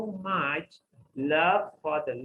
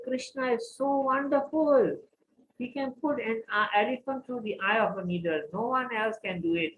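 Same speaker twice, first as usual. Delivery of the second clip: higher, gushing devotion; overjoyed praise; 7 semitones higher than usual. Acted. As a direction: sing his praise with childlike joy. Cobbler praising Krishna's power.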